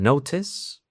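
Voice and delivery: "notice" with a fully pronounced T; true T. Shown as a demonstration. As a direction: release a crisp real T in "notice". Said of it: "con T verdadera".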